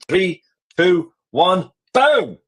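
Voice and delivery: countdown climbing to a high, punched boom; showman's countdown with a rising finish; dramatic countdown. Pretend. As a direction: build up like a big reveal, ending high on the boom. Mock countdown to a publishing reveal.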